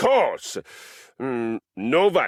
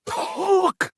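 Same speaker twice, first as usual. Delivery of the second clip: incredulous. Acted. Scoffing at mere talk.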